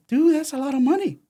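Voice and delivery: high-pitched retold astonishment; amazed reaction. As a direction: act out being floored, voice shooting up. Amazed reaction to the technician's pay.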